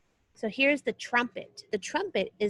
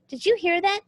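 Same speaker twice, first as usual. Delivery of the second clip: bright, higher and louder, eager to share; excited question; 7 semitones higher, 4 dB louder than usual. Real, not acted. Teacher asks pupils after the music, excited. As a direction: ask the class with real excitement.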